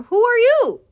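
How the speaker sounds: high, loud startled cry; shocked, demand to know who it is; father yelping in surprise at the masked figure. Acted.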